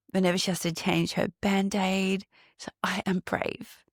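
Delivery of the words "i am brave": put-on whispered, tiny child's voice; cute little voice. Aunt mimicking niece changing her Band-Aid, whispered cute voice. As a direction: whisper it like a brave little girl. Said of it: "in the most cutest voice".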